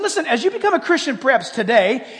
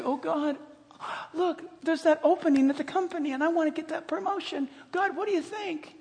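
soft, higher pleading voice; quiet mock prayer; 5 semitones higher, 9 dB quieter than usual. Believer asking God about a promotion, soft prayer. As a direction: ask softly, as if praying privately.

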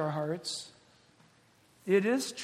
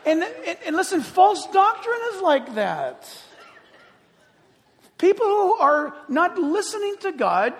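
higher, louder preaching through laughter; fiery preaching; 9 semitones higher, 7 dB louder than usual. Acted. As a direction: preach it with amused disbelief. Preaching at full force.

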